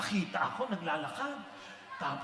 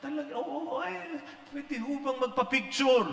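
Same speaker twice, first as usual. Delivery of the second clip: higher, eager fan voice asking for a picture; a shopkeeper begging for a photo, high and eager; excited fan; 8 semitones higher than usual. Retold or imitated. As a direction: gush like a starstruck fan. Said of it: "lumapit na at sinabi".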